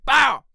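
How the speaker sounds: high, explosive exclamation for a twitch; sudden shouted "bow". Retold or imitated. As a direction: blurt it out like a sudden jolt.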